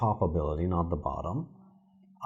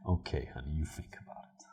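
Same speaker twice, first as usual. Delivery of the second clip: hushed breathy whisper, voice dropped low and soft; mock mystical; 5 semitones lower, 11 dB quieter, faster than usual. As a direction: whisper it like a spooky oracle. Teasing whisper after in-character talk.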